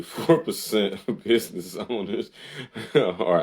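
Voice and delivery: reading through real laughter; laughing. Real, not acted. Host reading a statistic to viewers, laughing. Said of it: "I'm laughing 'cause y'all".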